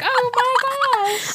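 high, slow, stunned gasp; drawn-out shocked oh my gosh. Retold or imitated. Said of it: "And she's like".

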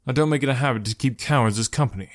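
usual speaking voice

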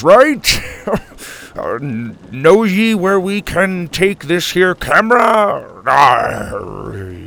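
loud, high, badly faked pirate talk; hammed-up pirate. Grandpa bluffing the crewmate, hammed-up pirate voice. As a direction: ham it up as an eager fake pirate.

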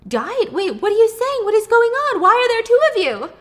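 shocked. Retold or imitated. Mom shocked by two daughters.

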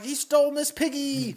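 high indignant Kermit. Retold or imitated. Kermit telling the mob about Piggy, high indignant Kermit. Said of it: "Kermit's like".

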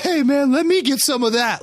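high-pitched imitation of a kidding dad; jovial dad. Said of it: "like, kinda laughing and smiles".

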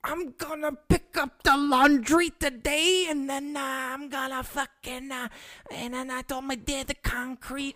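mock the fake Chicago tough guy, stretching every word. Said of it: "what guy from Arlington Heights says".

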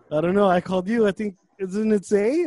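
loud and breathless; flustered, puffing out words; huffing. Retold or imitated. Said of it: "kinda huffing".

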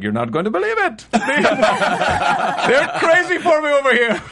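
high, giddy mock Turkish accent. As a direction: brag in a hammed-up Turkish accent, laughing. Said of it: "are we doing Turkish accents right now".

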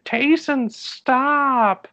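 high, loud mock mom yell. Mom yelling at her wild son at the pool, loud. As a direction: shriek his name like a fed-up mom. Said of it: "the mom kept yelling".

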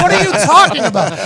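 high, loud, baffled retort; acted-out baffled protest; incredulous protest. Acted protest at friends' accusation.

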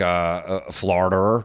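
"Florida" ending in -er. Acted. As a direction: say "Florida" as "Florder". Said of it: "we have the R's".

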